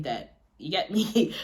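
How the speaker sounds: ordinary delivery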